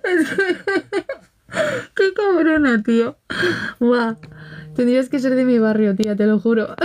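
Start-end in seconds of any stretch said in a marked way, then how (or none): 0.0-2.8 teary laughing voice; half crying with laughter. Real, not acted. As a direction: squeeze it out through laughter. Laughing so hard it sounds teary.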